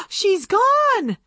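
high, breathless cry of dismay; alarmed cry. A narrator's reading. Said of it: "Annabelle cried softly".

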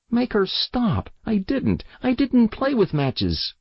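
put-on very high, desperate child's plea; tearful little boy. A narrator's reading. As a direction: protest frantically in a small boy's piping voice. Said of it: "while tears ran down his cheeks".

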